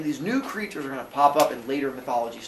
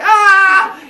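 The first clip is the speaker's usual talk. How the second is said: high, loud battle cry ah; attack yell; 13 dB louder than usual. Acted. Cronus jumps at his father, yelling. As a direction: leap with a yell.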